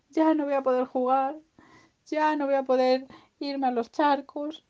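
high-pitched whiny lament; acted, childlike self-pity; very sad piglet.

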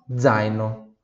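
ordinary delivery

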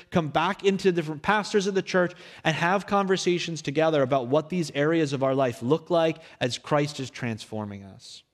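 ordinary delivery

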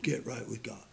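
soft unvoiced whisper of the preacher's line. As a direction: breathe it like a secret warning.

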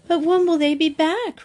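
put-on high, plaintive child's question, as read aloud; anxious question. A narrator's reading. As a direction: ask pleadingly, pitched high. Mary's anxious written question.

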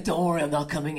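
a father calling through the door, low and quiet. Retold or imitated. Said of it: "he's me, one octave lower".

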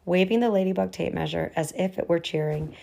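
ordinary delivery

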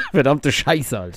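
lachend fluchen; laughing. Real, not acted. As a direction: mit Lachen in der Stimme fluchen. Witz über PlayStation fünf. (lachend fluchend)

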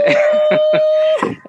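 sudden high delighted laugh; high burst of laughter. Real, not acted. Host laughs high in delight at the testimony quote. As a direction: let out a bright surprised laugh.

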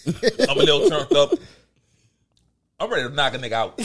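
panting, a little winded; out of breath. Real, not acted. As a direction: talk while catching your breath. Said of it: "I'm a little out of breath".

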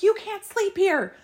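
high, fast, furious telling-off; angry yelling. Retold or imitated. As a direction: scold him hard and high. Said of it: "I'm like".

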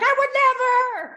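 high, loud fake innocence; mock innocent. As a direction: protest with exaggerated innocence. Mock reply to therapist.

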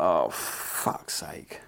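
slow, breathy whisper under the breath; exasperated whisper; whispered swear. Retold or imitated. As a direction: mutter it to yourself, defeated. Retold reaction to the big bill.

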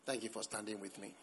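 very soft, low, quick thanks; dying spouse. Acted. Dying wife thanking her husband, hushed and low. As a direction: barely voice a last grateful word. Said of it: "a spouse was dying".

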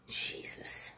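whispered, disbelieving aside; dismayed whisper. Real, not acted. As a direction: let it out under your breath, appalled. Dismayed aside after quoting purification.

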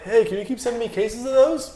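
high sheepish asking voice with a laugh; imitating Maverick. Maverick asking him to keep sending Red Bull, high mock-sheepish voice. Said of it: "he's like".

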